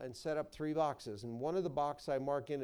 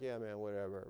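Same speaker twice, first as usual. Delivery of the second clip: low, softer too-cool-for-this shrug; bored older kid; 6 semitones lower, 5 dB quieter than usual. Retold or imitated. Preacher imitating older kids in chapel, mock bored cool. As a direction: toss it off like a bored preteen. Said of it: "the older ones are kind of cool".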